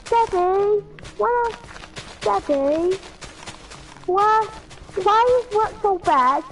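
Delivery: put-on high whiny kid asking daddy, slowing at the end; squeaky child voice. Kid mocking Peppa whining to Daddy, high and squeaky. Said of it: "We could either go like".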